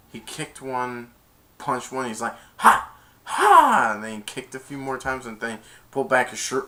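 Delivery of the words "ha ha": karate yells. Retold or imitated. Samurai strike cries.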